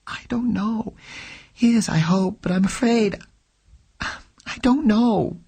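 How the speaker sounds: trembling with fear, pleading; cornered woman close to tears; frightened. Acted. Frightened woman answering questions.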